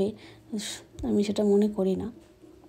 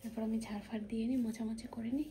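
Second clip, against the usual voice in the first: much softer, hushed whisper; 13 dB quieter than usual. Real, not acted. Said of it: "আস্তে আস্তে কথা বলছি".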